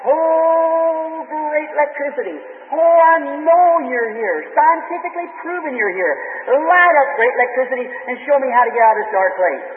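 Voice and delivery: high, drawn-out pleading cry into the dark; mock scream to electricity. Preacher pretends to cry out to electricity in a dark field, high pleading yell. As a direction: beg the empty air at the top of your voice. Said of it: "I'd scream to the top of my voice".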